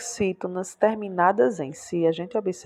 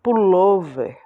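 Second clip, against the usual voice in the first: Pullover louder, with a strong "lo"; stress on penultimate syllable; 5 dB louder, slower than usual. Shown as a demonstration. Teacher demonstrating paroxítonas, loud stress on "lo" in Pullover. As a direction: punch the "lo" in Pullover. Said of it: "Também é a penúltima".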